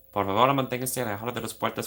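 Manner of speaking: fast, rattled-off subway announcement; quick recited Spanish; rapid Spanish announcement. Shown as a demonstration. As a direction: rattle it off like a train recording.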